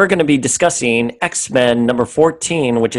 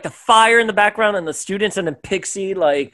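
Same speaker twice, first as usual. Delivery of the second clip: usual speaking voice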